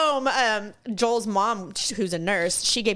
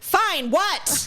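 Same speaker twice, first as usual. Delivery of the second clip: higher, curt mock irritation; impatient snap; 6 semitones higher than usual. Answering a friendly caller at work, impatient snap. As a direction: cut off the pleasantries.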